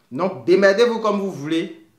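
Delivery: exasperated loud outburst; blunt loud aside. Real, not acted. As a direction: throw it at them bluntly, sort it out yourselves.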